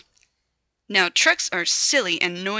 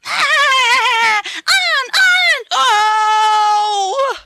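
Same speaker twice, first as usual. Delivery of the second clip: excited; 10 dB louder, much slower than usual. Acted. Trucks rattling and laughing.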